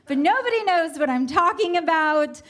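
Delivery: normal talking voice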